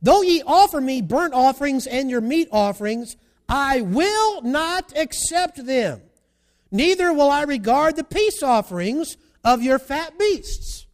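loud, slow, emphatic verse; preached scripture reading. Acted. Preacher reads God's rebuke to congregation, loud and deliberate. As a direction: declaim the verse with weight.

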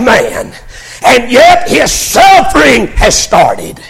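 high, driving sermon shout; full-force preaching. Acted.

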